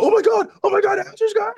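very high, loud fangirl squeal; squealing fan. Retold or imitated. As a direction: shriek it like a starstruck fan. Excited fan recognizing him.

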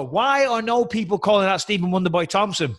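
ordinary delivery

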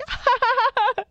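very high-pitched acted laughing fit; imitated hysterical laugh. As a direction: burst into high, unhinged mock laughter. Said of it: "laughing, and then I just started".

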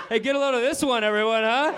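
mock a kid elbowing the class over a dirty joke; juvenile snicker. Comic nudging the yuppie couples, high giggly kid voice.

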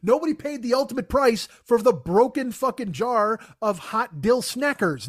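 ordinary talk, nothing marked

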